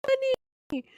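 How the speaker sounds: high-pitched, giggling through the words; can barely get it out for laughing. Real, not acted. Streamer cracking up over a post she is reading, laughing. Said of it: "just laughing myself with this thing".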